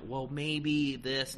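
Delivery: mock indecisive hedge; mock waffling. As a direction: drag it out like an unsure pushover. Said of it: "There's no like".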